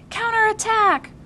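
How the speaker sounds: high, slow, drawn-out shout; answering battle cry. A narrator's reading. Opposing side yells back in the game. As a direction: answer the charge loud. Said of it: "came an opposing shout".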